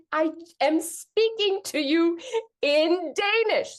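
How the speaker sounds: slow, high, word-by-word insistence; indignant, every word spaced out; exasperated retort. Retold or imitated. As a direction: spell it out slowly in mock outrage. Acts exasperated retort to couple.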